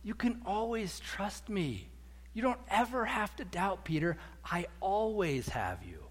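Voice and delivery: soft, slow and comforting; tender reassurance. Acted. Jesus to sinking Peter after grabbing him, tenderly reassuring. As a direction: reassure gently, taking your time. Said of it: "I think it went something like this".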